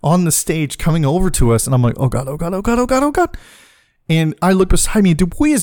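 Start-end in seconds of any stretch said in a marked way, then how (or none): 2.0-3.4 rising panic. Retold or imitated. Panicking as stripper approaches.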